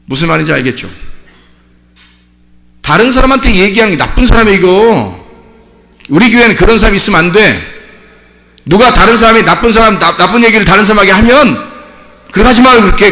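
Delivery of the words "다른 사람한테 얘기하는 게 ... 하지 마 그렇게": raised, insistent sermon voice; forceful preaching. Real, not acted. Preacher warning church against talking behind backs, forceful preaching. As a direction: drive the warning home from the pulpit.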